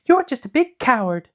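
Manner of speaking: high, loud scolding; Dorothy scolding the lion as a coward. A narrator's reading. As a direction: tell the lion off sharply. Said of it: "Dorothy scolded him".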